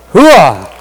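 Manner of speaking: army cheer; loud gung-ho soldier. Real, not acted. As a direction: bark it like a drill sergeant. Military cheer shouted to audience.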